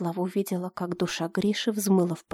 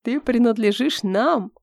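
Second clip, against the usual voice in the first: higher, slow wheezing menace of a dark monk; hoarse monk; 4 semitones higher than usual. Acted. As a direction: wheeze out the claim like a sinister monk. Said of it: "прохрипел монах".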